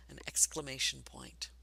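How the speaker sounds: usual speaking voice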